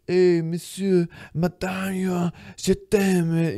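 high, slow mock French accent; French smooth-talker. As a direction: put on a hammed-up French seducer. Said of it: "he starts talking all French".

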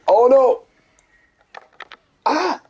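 high panicked yelp; panicked cry. Real, not acted. Grenade goes wrong.

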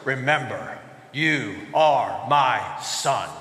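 very low, slow, regal lion; low, stately lion-dad rumble; deep lion voice. Acted. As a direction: speak deep and slow like a big father lion. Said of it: "in a deep lion voice".

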